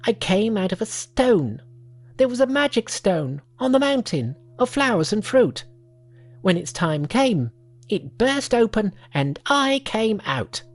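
high, odd little monkey voice. Acted. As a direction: speak as an eager young monkey.

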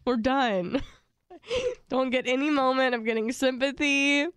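normal talking voice

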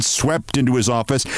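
plain reading voice

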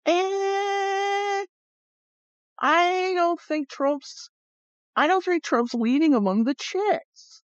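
high, drawn-out doubting voice; comic skepticism. Acted. Acts out own doubtful reaction.

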